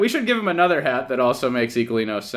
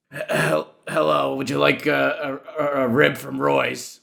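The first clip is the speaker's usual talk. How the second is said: rough smoker's voice, lightly done; smoker's rasp. Pretend. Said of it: "How do you imagine, uh, Dina talks?".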